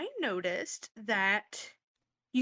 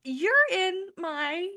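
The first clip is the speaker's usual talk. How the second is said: put-on higher, girly cheerleader greeting; 7 semitones higher, much slower than usual. Cheerleader Vicky greets Jojo in a higher girly voice. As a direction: pitch it up to greet the boy from class. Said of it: "she walks up to him and goes".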